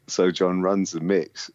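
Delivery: ordinary delivery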